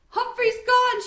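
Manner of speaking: high, loud panicked wail. Acted. Girl runs to door, wails that hamster is gone. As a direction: wail it out in despair. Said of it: "she wailed".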